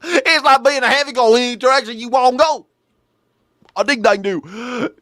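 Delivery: high, loud hillbilly parody; mock country old-timer accent. Host mimicking the old man's heaven line, high country accent. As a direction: parrot him in an exaggerated country twang. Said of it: "guys that talk like this".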